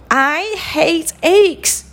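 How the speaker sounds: high, slow whiny complaint; drawn-out mock tantrum; childish protest. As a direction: whine it like a fussy little princess. Princess refuses breakfast, sulking.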